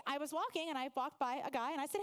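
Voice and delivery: ordinary talk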